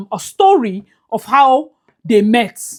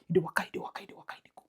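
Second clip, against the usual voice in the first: whisper; 5 semitones lower, 17 dB quieter, much faster than usual. Pretend. Mimics her walking away.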